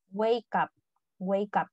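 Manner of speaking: "wake up" repeated as one word, "way-kap"; linked as one word. Shown as a demonstration. Teacher repeating for students, "wake up" linked as one word. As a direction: link "wake up", with "up" like "ap". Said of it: "como si fuera una sola palabra".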